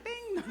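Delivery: high, soft little bing; ding like a kitchen timer; imitated oven ding.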